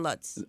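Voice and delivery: Lutz said with a short U, "luts"; short U pronunciation. Real, not acted. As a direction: say Lutz to rhyme with "cuts". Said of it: "no other vowel to make it a long U".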